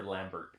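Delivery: Lambert with the T sounded, "LAM-bert"; American pronunciation. Shown as a demonstration. Querying the co-host's version, American-style Lambert. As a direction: say Lambert English-style, closing on a T. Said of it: "how to say Lambert".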